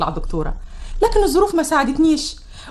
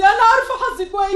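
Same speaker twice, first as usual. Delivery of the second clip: higher still, tearful laughing sob; breaking down; 8 semitones higher than usual. Acted. Daughter to mother about her fate, breaking down. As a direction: let it crack further on the repeat.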